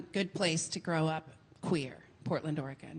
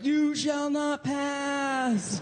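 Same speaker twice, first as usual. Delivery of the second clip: 1 mock Gandalf; 7 semitones higher, much slower than usual. Gandalf quote in stand-up bit.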